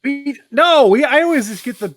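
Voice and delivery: high, loud defensive protest; excited protest. Real, not acted.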